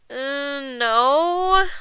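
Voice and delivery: hesitant, drawn-out no; sheepish no, sliding upward; guilty child. A narrator's reading. Guilty child answers mother.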